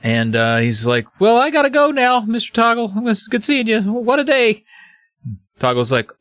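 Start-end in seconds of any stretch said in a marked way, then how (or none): 1.2-4.6 high-pitched, hurried fake casualness; nervous excuse. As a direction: play an awkward escape, voice way up. Jerry nervously excusing himself to flee.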